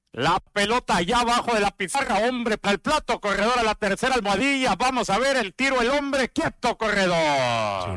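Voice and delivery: rising, fast live baseball call; excited play-by-play. Acted. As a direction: call the hit as it happens.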